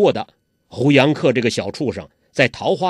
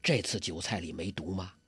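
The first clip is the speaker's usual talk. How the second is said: much softer, hushed worried question; 8 semitones lower, 15 dB quieter than usual. Acted. 郭靖小声问师傅酒菜有没有毒. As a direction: ask under breath. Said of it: "郭靖小声说".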